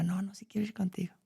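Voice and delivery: hushed whisper, barely voiced; a soft, voiceless aside. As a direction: whisper the daughters' plea. Daughters asking to come along.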